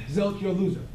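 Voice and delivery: ordinary talk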